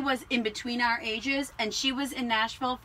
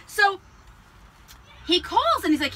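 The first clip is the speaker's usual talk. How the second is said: normal talking voice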